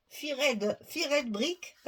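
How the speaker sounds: English read with a thick French accent; French speaker's English, tapping out "Fired Brick". Real, not acted. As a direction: say "Fired" as fee-RED, French-style. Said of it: "qui aiment bien mon accent anglais".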